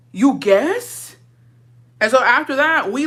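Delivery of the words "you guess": higher, louder, swooping up on "guess"; loud scandalised query; louder incredulity. Retold or imitated. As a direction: hit "guess" with outraged disbelief. Repeats the disbelief louder.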